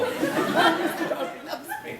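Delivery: high, slow, dreamy mock swoon; hammed-up lovestruck sigh; swooning heroine. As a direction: play the smitten Austen heroine. Fantasising about Jane Austen hero.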